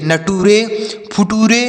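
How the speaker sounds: "नटूरे, फुटूरे" read letter by letter, ending in "रे"; beginner's misreading. Shown as a demonstration. Teacher showing students how not to read, spelled-out "नटूरे, फुटूरे". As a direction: sound out "नटूरे, फुटूरे" with a full "टू" and "रे". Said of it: "ऐसे आपको नहीं पढ़ना है".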